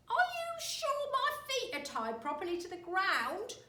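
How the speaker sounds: put-on high, loud fretful old wife; anxious Mrs Twit. A narrator's reading. Wife fretting about the strings at her feet. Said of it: "she grasped".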